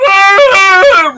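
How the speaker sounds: high, loud crying shout of relief; loud crying yell. Acted. NPC runs to hug the player, crying out loud and high. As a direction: wail it out as he rushes in. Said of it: "he goes".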